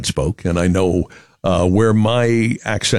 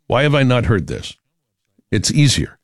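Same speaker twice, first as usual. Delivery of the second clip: normal talking voice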